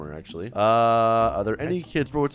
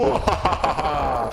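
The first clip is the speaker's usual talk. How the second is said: put-on villain cackle; drawn-out mock evil laughter. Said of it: "I'm like an evil villain".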